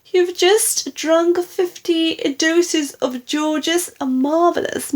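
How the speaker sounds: high, loud, horrified lament; wailing. A narrator's reading. Daughter telling mother of the overdose, high wailing alarm. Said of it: "wailed Missus Cranky".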